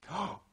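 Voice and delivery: high, sudden gasp of fright; acted-out sharp intake of breath; startled gasp. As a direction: gasp as if spotting someone staring. Turns and sees staring monk.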